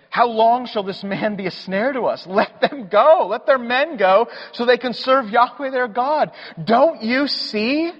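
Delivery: high, urgent pleading counselors; pleading servants. Retold or imitated. Servants begging Pharaoh to let the people go, urgent. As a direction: beg the king to give in, voice climbing. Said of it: "his servants come to him and say".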